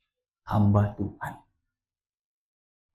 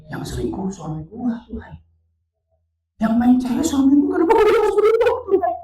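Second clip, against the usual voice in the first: high plea growing louder; indignant protest; 5 dB louder than usual. Retold or imitated. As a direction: argue back at God, climbing in pitch. Wife protests God's answer. (protes memelas makin melengking)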